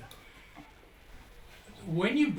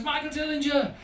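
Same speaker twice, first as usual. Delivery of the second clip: excited greeting; 9 semitones higher, 3 dB louder than usual. Retold or imitated. Excited surgeon calls his name.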